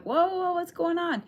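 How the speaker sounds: high, alarmed mock exclamation; startled whoa. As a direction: play the startled friend pulling back. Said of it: "you're like".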